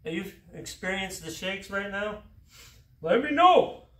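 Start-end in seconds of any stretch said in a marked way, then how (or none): 3.0-3.9 higher, louder call mid-effort; strained shout. Real, not acted. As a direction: force it out under load.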